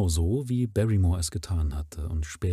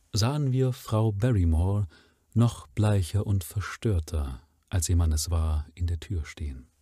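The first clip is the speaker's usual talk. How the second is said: ordinary talk, nothing marked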